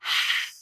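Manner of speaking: big exhaled sigh through the mouth; long, voiceless release of breath. Shown as a demonstration. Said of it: "with a big sigh".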